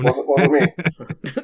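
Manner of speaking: very slow, laughing innocent drawl; drawn-out fake denial.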